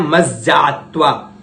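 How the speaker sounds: मज्जात्वा with held double ज; doubled j. Shown as a demonstration. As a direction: press the ज्ज in मज्जात्वा. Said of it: "इसका उच्चारण इस प्रकार करना है". (मज्जात्वा में ज्ज पर ज़ोर)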